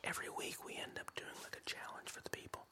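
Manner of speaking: soft unvoiced whisper. Real, not acted. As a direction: whisper it under your breath. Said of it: "Why are you whispering?".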